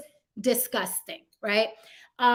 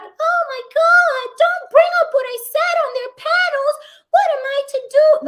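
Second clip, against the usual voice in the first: mock panicked fretting; 8 dB louder than usual. Mocking critics who expect her to cower, high panicked whine. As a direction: play a helpless frightened victim. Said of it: "I'm gonna be like".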